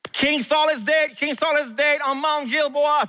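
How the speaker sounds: high, slow cry of news; crying out that the king is dead; alarmed announcement. A narrator's reading. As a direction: announce the king's death in alarm.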